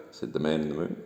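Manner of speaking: usual speaking voice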